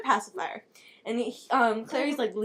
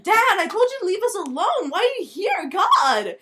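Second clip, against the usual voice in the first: put-on annoyed teen; 7 semitones higher, 6 dB louder than usual. Clary yelling at her dad.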